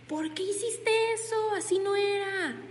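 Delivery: high, sharp scolding reproach; mock boss scolding an employee. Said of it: "En modo de regaño".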